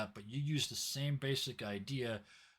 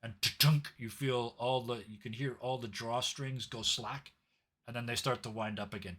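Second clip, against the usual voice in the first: normal talking voice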